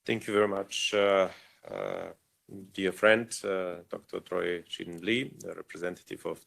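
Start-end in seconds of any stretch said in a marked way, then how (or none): none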